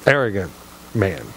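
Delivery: arrogant voice. Pretend. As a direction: put on a pompous low sneer. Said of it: "That's the best I can do with that".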